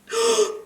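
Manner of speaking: high, short gasp; shocked gasp. Real, not acted. Cutter regretting the last snip, shocked high gasp. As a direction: gasp at what you just did.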